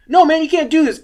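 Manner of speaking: high, loud mock objection; imitated loud protest. Gas station attendant to the man grabbing the can, protesting loudly.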